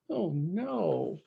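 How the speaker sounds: usual speaking voice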